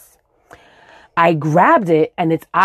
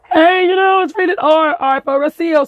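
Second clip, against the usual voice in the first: mock announcer; 10 semitones higher than usual. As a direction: tease yourself like a movie trailer. Mocking the movie rating.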